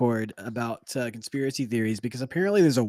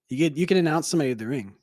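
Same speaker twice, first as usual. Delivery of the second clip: ordinary delivery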